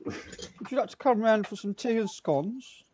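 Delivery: put-on high, slow posh English hostess accent. Joining the posh banter in posh English accent. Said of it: "I'll get the pipe out".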